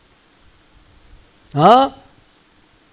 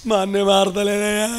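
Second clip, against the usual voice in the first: mock helpless whine; 3 dB quieter than usual. Shown as a demonstration. Preacher mimicking someone praying about a brother, mock whine. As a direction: drag out the last syllable pleadingly.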